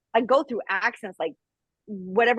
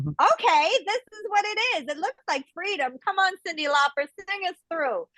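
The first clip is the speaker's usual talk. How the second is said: higher-pitched, playful mock enthusiasm; mock eager buy-in; 9 semitones higher than usual. All of us buying into the TV dream, mock eager. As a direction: act out naive eagerness in a raised voice.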